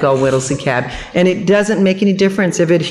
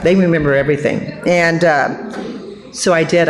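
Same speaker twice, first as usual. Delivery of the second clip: ordinary talk, nothing marked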